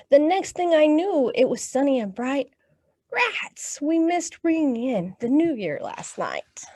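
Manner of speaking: put-on picture book read aloud to kids; lively storytime reading; story reading. Teacher reading a New Year book to children, storytime voice.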